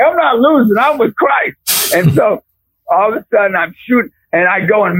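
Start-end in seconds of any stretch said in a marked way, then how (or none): 0.0-1.9 triumphant declaration. Retold or imitated. Preacher declaring victory.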